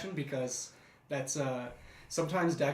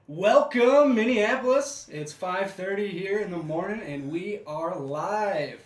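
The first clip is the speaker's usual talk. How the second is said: booming high-energy radio host, much higher and louder; morning radio voice; 6 semitones higher, 11 dB louder, slower than usual. Pretend. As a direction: hype up the early-morning listeners like a DJ. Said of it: "we were imitating a little radio voice".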